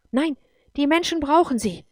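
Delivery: high, loud protest; sudden cry. A narrator's reading. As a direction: burst out in objection. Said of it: "schrie Anne plötzlich".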